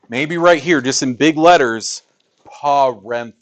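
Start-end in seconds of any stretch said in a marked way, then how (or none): 2.4-3.3 "parentheses" stretched out, each syllable separate; dictated syllable by syllable. Shown as a demonstration. Teacher dictating for students' papers, "parentheses" syllable by syllable. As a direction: spell out "parentheses" by sound, syllable by syllable. Dictating a word to write big.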